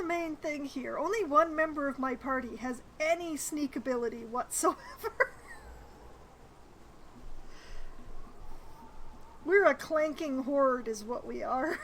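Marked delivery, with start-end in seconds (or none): 4.8-9.4 long breathy wheezing laugh; long soft laugh. Real, not acted. Player laughing at clanking party, long and breathy. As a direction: laugh helplessly, quietly.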